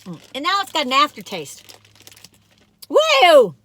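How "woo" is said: loud, high startled woo; surprised whoop. Real, not acted. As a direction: yelp at the aftertaste. Reacting to a strong aftertaste.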